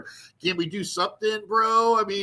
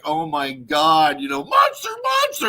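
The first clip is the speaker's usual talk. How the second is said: shocked gasp; 3 dB louder than usual. Retold or imitated. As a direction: shriek it like you've spotted a celebrity. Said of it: "she was looking at me like".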